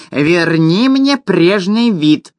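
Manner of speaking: high, slow wail of a tiny gnome. Acted. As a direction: howl the demand like a desperate gnome. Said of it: "вопил гном".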